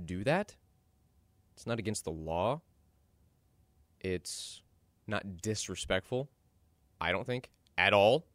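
ordinary delivery

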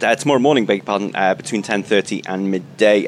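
ordinary delivery

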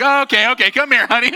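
high, loud hurried comfort; frantic mom soothing. Retold or imitated. Mom grabbing her scared son after grandma's demon talk, high and urgent. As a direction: rush in to calm a panicking child. Mom calms frightened child.

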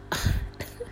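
quiet little giggle; soft laugh. Real, not acted. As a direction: laugh softly to yourself. Laughs before starting her story.

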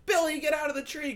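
high-pitched mock mom holler; mom calling out. Mom to Billy up a tree, high mock holler. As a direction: imitate a mother calling her kid down. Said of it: "some mom who's like".

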